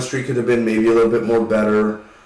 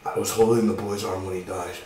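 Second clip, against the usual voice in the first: mock mumble; 4 semitones lower, 6 dB quieter than usual. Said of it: "He's like".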